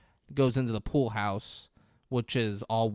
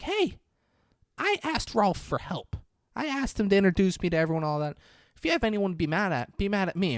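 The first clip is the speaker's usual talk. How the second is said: raised, higher woman's voice; Carol's voice; 9 semitones higher, faster than usual. Retold or imitated. Girlfriend stepping in to defend Rolf, pleading high voice. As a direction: pitch it up as an earnest girlfriend.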